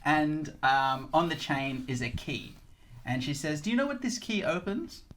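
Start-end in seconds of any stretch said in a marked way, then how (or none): 3.6-5.0 put-on higher, teasing old woman; teasing matriarch. Grandolpha teasing the cat about the key. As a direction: dangle the question playfully.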